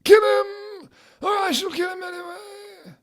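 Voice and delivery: very high shrieking villain threat, cracking; Voldemort voice. Acted. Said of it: "do more of a Voldemort voice".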